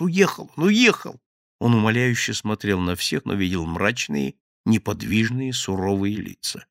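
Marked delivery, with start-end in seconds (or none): 0.0-1.2 high, loud frantic insisting; pleading insistence. Acted. Thief insists to the gang, frightened. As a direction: insist desperately.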